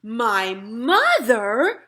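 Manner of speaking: slow, drawn-out astonished cry; as if the idea is absurd. Acted. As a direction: giant amazed at the question, slowly. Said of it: "cried the B F G".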